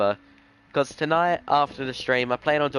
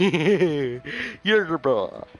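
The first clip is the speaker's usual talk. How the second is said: silly cartoon voice; 5 semitones higher, much slower than usual. Repeating a cartoon line.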